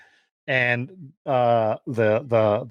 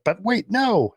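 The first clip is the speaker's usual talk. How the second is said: acted objection in higher pitch; alarmed protest; 7 semitones higher, slower than usual. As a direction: protest suddenly, pitch jumping up. Retells hero's alarmed protest.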